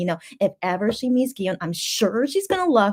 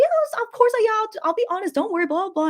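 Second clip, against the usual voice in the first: high, eager mock mom reassuring me; mom's eager voice; 12 semitones higher than usual. Daughter voicing her tipsy mom's eager promise, high and bright. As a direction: imitate mom promising to be honest, pitched high. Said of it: "so she's like".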